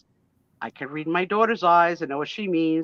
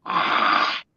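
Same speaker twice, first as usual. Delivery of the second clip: voiceless breathy mock hiss; imitated cat hiss. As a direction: hiss like a little kitten at a stranger. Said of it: "looked at Tom in the face and went".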